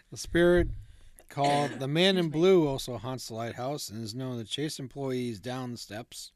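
normal talking voice

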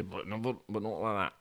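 plain reading voice

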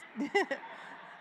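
short breathy laugh. Real, not acted. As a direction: laugh it off, embarrassed. Laughs at accent remark.

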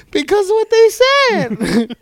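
high, slow, put-on grandma voice; grandma's late reply. Grandchild mimicking Grandma explaining a joke, high and slow. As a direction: squeak it out slowly like grandma. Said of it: "Why are you laughing?".

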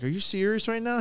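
high-pitched amused disbelief; incredulous reaction. Retold or imitated. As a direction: voice an astonished guy in the next stall. Retold second man's amazed reaction.

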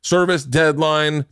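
upbeat ad read with lifted key lines; podcast ad read. Real, not acted. Host pitching a freelancer service to listeners, ad read. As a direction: sell it like a host-read sponsor spot.

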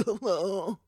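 posh, bumbling groans, put on; Boris Johnson. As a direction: mimic a posh politician's wordless huffing. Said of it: "like, so posh. And it's like".

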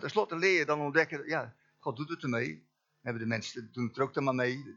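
ordinary delivery

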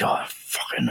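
imitated cursing under the breath; whispered mock self-directed anger; angry at himself. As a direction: curse to yourself, almost voiceless. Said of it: "getting angry at himself".

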